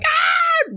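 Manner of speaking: short, loud high-pitched shriek; high yelp of alarm; mock girlish scream. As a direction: imitate startled girls screaming. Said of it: "She starts screaming".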